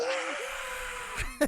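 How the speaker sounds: high shrieked "Ah!" of a scared crowd; mock audience scream. Imitating the screaming crowd in the video, shrieking. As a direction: shriek like a theater full of scared people.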